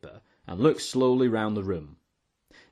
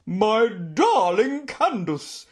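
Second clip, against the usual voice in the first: slow, high, loud effusive aunt; booming; 11 semitones higher, 5 dB louder, much slower than usual. A narrator's reading. Aunt greeting me across the room, booming. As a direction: boom it out, stretching every word. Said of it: "she boomed".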